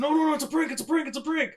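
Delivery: high frantic no no it's a prank; panicked high pleading; panicked camera guy. Retold or imitated. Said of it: "the camera guy said".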